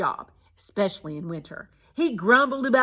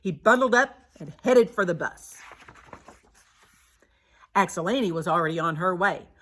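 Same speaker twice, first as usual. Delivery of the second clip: plain reading voice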